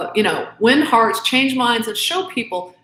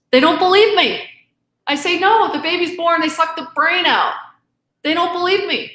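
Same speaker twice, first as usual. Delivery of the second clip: higher pitched and heated, feelings rising; getting emotional; 6 semitones higher than usual. Real, not acted. Guest telling the priest nobody believes her, voice pitched up with emotion. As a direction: voice climbs as the feeling takes over. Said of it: "I get a little emotional".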